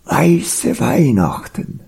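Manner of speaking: slow, high, blissful polar bear; rapturous sigh of delight in words; enraptured swooning. A narrator's reading. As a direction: savour it dreamily, stretching the words. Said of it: "er schwärmte verzückt".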